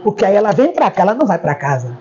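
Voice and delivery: ordinary talk, nothing marked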